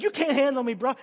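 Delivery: high, very loud mock taunt; defiant shout. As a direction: imitate a kid yelling defiance mid-struggle. Struggling kid taunts him.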